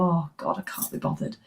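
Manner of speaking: imitated can't-be-bothered whisper; a lightly acted, drained whisper of self-pity; mock weary groan. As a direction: drain all energy out of it. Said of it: "you go".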